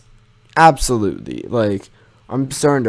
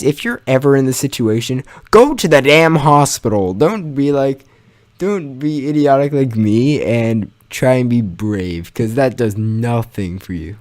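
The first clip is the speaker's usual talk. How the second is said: usual speaking voice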